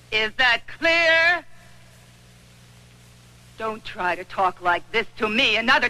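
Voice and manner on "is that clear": slow, higher, each word hammered; slow it down and lean on clear; angry demand. Acted. She repeats her angry challenge.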